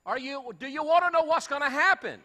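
shouted high and loud at the crowd; preacher's shout. Acted. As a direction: call it out, challenging the congregation.

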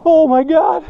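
high-pitched amazed cry; real excitement. Real, not acted. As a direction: burst out high at landing the catch. Lands a giant fish.

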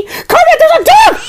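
high squealing call to Kermit, hammed-up; mock yell. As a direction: yell it up high like a panicked kid.

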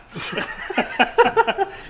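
high squeaky unvoiced giggle; squeaky gleeful gremlin giggle. Gremlin noises after slap.

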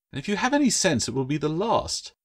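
put-on high, wry girl's warning; young girl voice. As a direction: tease him with a sly warning. Claire warns gecko.

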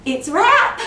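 high, loud, excited call; gleeful announcement. Acted. Suspect calling a wrap to the camera, gleeful shout. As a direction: crow it out like a film wrap.

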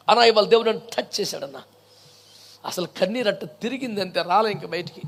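low, hushed confiding voice; mock testimony, dropped low and soft; mock-moved believer. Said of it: "feeling వచ్చింది ఒకడికి".

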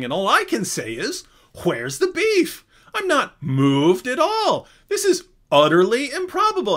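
high, loud, slow mock-indignant professor; Craig impression. Craig mocking the cow argument, loud and high. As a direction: ham up an outraged scholar. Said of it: "Craig starts making fun of this".

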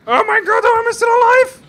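very high, loud disbelief; screaming surprise; shocked shriek. Real, not acted. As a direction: squeal in amazement at still being alive. Shocked to survive.

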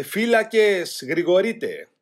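loud, high, drawn-out watch cry; sentry call. A narrator's reading. Sentry calling to the other guard posts at night, drawn-out shout. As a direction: call it out long across the dark.

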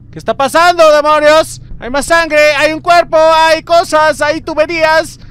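high, loud panicked outburst; alarmed yelling. Real, not acted. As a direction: rattle off the horrors in rising alarm. Alarmed at bodies in horror game. (gritos agudos de alarma)

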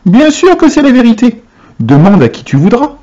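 high, insistent exclamation easing down; high-pitched assurance without full volume; crying out. A narrator's reading. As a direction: vouch for it eagerly, as the old folk. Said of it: "crièrent les quatre vieux en chœur".

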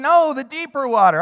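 loud call of the cousin; yelling cousin. Retold or imitated. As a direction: shout it out to the kid on the pier. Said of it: "he's still screaming".

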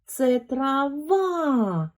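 ТРАВА very slow and high, long ВА; stress on second syllable. Shown as a demonstration. Teacher repeats to prove the stress, long stressed ВА in ТРАВА. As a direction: lean on and hold the last syllable of ТРАВА. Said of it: "Більший наголос якраз на другому складі".

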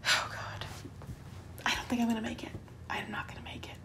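whispered, giving up; defeated whisper. Real, not acted. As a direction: breathe it out like you're done for. Overwhelmed by the game.

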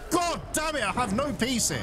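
high exasperated cry; frustrated outburst. Real, not acted. Gamer fed up with constant machine attacks.

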